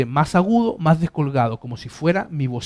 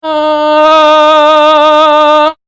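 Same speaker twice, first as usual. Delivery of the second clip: chest resonance ah; 10 semitones higher, 12 dB louder than usual. Shown as a demonstration. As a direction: sing ah with a fuller, more solid body. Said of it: "ese sonido ahora tiene mayor carácter, tiene mayor solidez".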